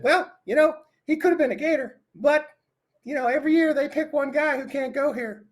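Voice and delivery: high, squeaky cartoon mouse impression of Steve; Mickey Mouse voice. Retelling the coach's quote as Mickey Mouse. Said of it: "using the Mickey Mouse voice".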